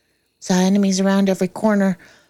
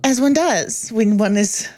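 normal talking voice

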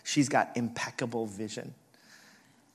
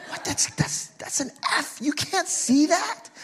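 very high, louder mock outrage; agitated mom; 6 dB louder than usual. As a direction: squawk in disbelief like an exasperated mom. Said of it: "She's growing increasingly agitated".

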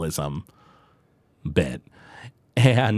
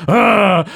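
mock yell; 7 semitones higher, 6 dB louder than usual. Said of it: "Homer's like".

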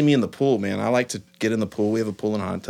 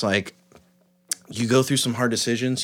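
ordinary delivery

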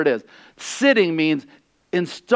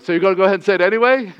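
fast, louder, incredulous comeback; mock-incredulous retort; 4 semitones higher, 4 dB louder, much faster than usual. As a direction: throw it back at them fast and disbelieving. Preacher's retort to kids.

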